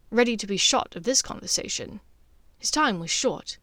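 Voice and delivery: usual speaking voice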